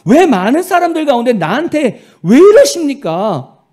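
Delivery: loud, high mock complaint to God; complaining to God. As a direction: whine it out like a wronged believer. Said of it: "라고 원망한다는 거예요".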